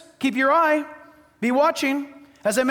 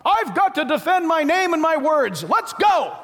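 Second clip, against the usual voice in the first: mock eager hothead; 5 semitones higher, slower than usual. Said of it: "That's why you don't go".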